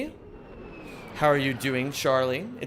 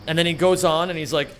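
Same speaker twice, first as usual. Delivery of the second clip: normal talking voice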